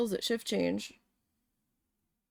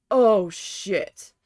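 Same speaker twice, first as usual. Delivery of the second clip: dismayed oh shit; 2 semitones lower, 7 dB louder than usual. Retold or imitated. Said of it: "I'm like".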